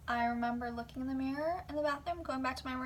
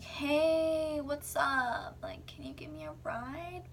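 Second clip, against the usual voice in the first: drawn-out, higher-pitched casual ask; wheedling request; 5 semitones higher, much slower than usual. Retold or imitated. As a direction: imitate a too-friendly phone call fishing for a favor. Phone call asking for a ride.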